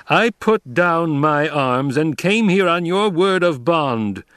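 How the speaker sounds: high, loud, drawn-out protest; shouted. A narrator's reading. Betrayed Emperor to Paul, shouting. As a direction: shout it in outrage, stretching the words. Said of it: "the Emperor shouted".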